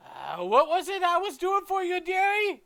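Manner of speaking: very high, loud, scatterbrained granny; forgetful shopkeeper. Acted. Shopkeeper forgetting the customer's request, very high and loud. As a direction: sing it out high, having lost the thread. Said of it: "she seems deep in thought for a second".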